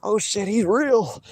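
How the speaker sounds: high, slow startled gasp of mock panic; panicked opponent. Streamer imitating his beaten rival's mild mock panic. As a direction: play the rival realizing too late, shaken. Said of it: "And he'd be like".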